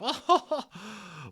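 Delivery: a big high Matron laugh, falling away; high hearty laugh. Acted. Matron laughing at herself, loud high laugh. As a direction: laugh out loud like a jolly nurse. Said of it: "she began to laugh".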